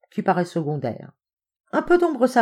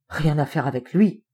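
usual speaking voice

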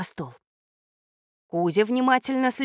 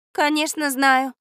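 mildly cross, slow and higher; cross reply; 6 semitones higher, 3 dB louder, slower than usual. A narrator's reading. Girl answers the boy's question, mildly angry. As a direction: answer with slow, raised-pitch irritation. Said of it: "сердито ответила Катя".

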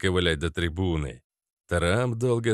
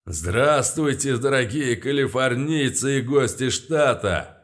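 booming; 6 semitones higher, 3 dB louder than usual. Acted. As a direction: rumble out a grand greeting. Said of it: "пророкотал я".

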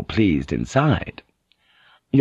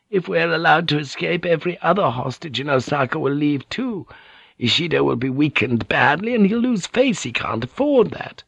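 slightly higher, confident woman's part; higher-pitched character reading; lightly voiced Mariko; 6 semitones higher than usual. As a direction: play a lady arguing her case, lightly voiced. Voices Mariko reasoning in dialogue.

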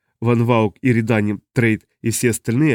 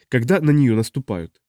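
ordinary delivery

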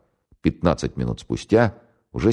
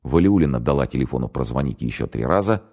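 usual speaking voice

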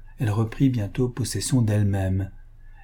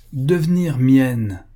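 louder, slow, forceful repeat; 4 semitones higher, 7 dB louder, much slower than usual. A narrator's reading. Don Ramiro repeating his demand to Louisa, forceful and slow. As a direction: hammer the words out slowly. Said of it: "répéta-t-il avec force".

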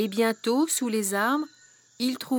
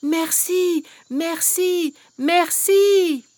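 higher, louder thank-yous; mild joyful shout; crying out thanks; 5 semitones higher, 5 dB louder, much slower than usual. Acted. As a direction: call out merci while running off. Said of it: "en criant".

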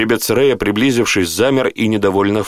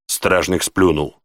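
ordinary delivery, nothing marked

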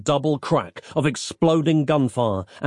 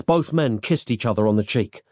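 plain reading voice

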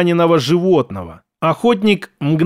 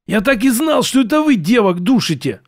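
strained wheeze, higher pitch; wheezing; 5 semitones higher than usual. Acted. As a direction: wheeze it out under pressure. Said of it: "просипел тот".

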